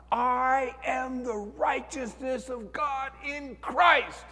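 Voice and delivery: high, slow, ringing shout; the last triumphant shout in the car; shouted declaration. Retold or imitated. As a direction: proclaim it with everything you have. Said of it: "I shouted it".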